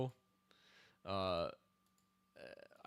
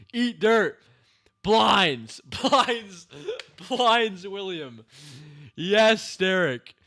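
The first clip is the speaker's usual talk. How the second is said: high, very loud triumphant cheer; excited shouting; 8 semitones higher, 13 dB louder, much slower than usual. Real, not acted. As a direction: yell in delight at the move. Cheering the kid's move.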